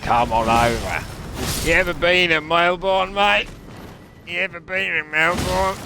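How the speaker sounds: loud, high-pitched hammed-up Aussie; broad Australian, pushing the pitch up; Australian accent. Aussie asking a mate about Melbourne, hammed-up Australian accent. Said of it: "Or other Australian terms".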